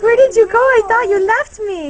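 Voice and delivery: voicing the pet. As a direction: play the dog's complaint out loud. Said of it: "He's like".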